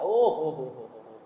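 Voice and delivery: low, rolling ho-ho giggle; giggling laugh. A narrator's reading. Monk acting the idle son laughing at his family, low giggle. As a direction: chuckle like a carefree lazy son. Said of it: "खिलखिला कर हंस रहा है".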